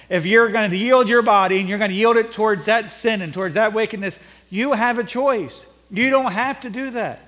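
loud, urgent pulpit exhortation; forceful preaching. Acted. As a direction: preach it hard, punching key words.

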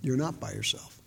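low, very soft, with spaced phrases; the Lord reassuring. Retold or imitated. The Lord answering one who feels alone, quietly reassuring. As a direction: comfort quietly, letting each phrase settle. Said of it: "the Lord just says".

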